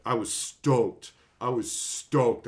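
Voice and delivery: ordinary talk, nothing marked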